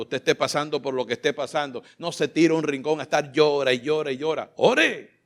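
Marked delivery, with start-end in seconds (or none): 4.6-5.1 urgent cry. Real, not acted. Preacher urging to pray.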